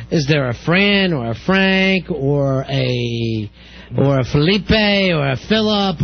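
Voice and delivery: slow, drawn-out guessing at names; imitated hesitant guesswork; mock psychic fishing. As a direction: stall between names as if fishing.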